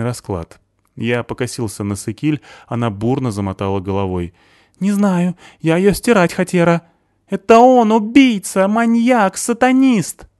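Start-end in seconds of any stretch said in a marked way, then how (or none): 4.8-6.8 put-on frightened girl. A narrator's reading. Sykil answers, high girl voice. 7.3-10.3 high, loud hysterical accusations; panicked screaming; hysterical shouting. A narrator's reading.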